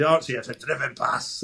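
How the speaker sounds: put-on light Scottish Dundee accent; a hint of Dundee accent. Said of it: "so that's my Dundee accent".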